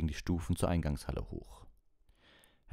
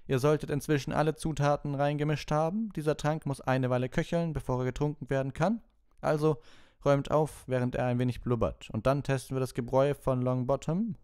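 high, loud, cutting teacher's command; cold Snape; 10 semitones higher, 5 dB louder than usual. Acted. Snape ordering the class to clean up, cutting. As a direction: bark orders across the dungeon.

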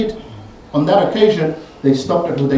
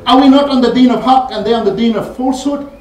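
indignant questioning; 9 semitones higher, 7 dB louder than usual. Retold or imitated. Omar presses Abu Bakr.

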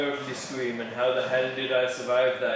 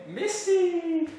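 Misty voice. Acted. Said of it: "I'm just doing what Misty would do".

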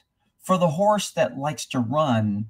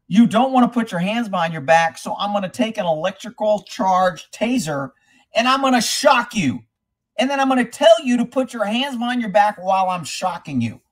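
higher, louder mock cop ordering; 6 semitones higher, 3 dB louder than usual. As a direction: ham up a bullying cop. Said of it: "it's kinda like a cop saying".

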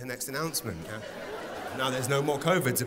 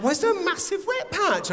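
much higher, accusing wife; wife's shrill question; 4 dB louder than usual. Retold or imitated. Wife confronting husband about a wet patch, high accusing. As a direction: demand it like the wife. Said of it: "my wife's like".

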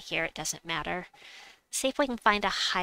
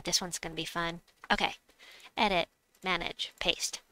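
normal talking voice

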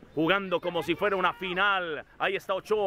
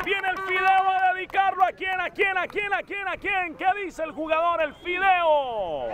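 play-by-play; 11 semitones higher than usual. Real, not acted. Live kid's football play-by-play.

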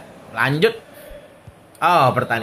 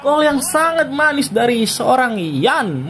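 excited; 9 semitones higher, slower than usual. Real, not acted. Right after the goal call.

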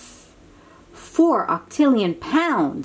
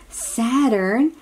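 slow emphasis; 3 semitones higher, much slower than usual. Shown as a demonstration.

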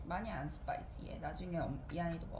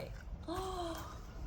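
light high gasp; high mock gasp; 11 semitones higher, 5 dB quieter than usual. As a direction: gasp in play surprise. Mother gasps at new page.

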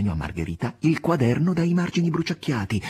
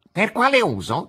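high, louder stern cat; stern question; 11 semitones higher, 6 dB louder than usual. A narrator's reading. The cat questioning Nikolai Ivanovich, sternly. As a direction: demand it sternly. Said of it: "domandò con severità il gatto".

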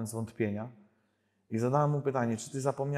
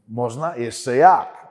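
papal catchphrase with a punched, louder answer; John Paul II; 7 dB louder, much slower than usual. Retold or imitated. Preacher showing the audience how the pope talks, emphatic imitation. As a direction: imitate the pope, swinging up loudly on the reply. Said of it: "On tak".

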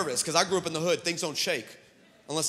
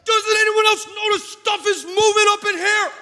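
high, loud, alarmed shout; full-volume high-pitched comic outburst; panicked yell; 10 dB louder, slower than usual. Acted. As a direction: yell out over the shaking for help. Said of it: "And finally, I just yell".

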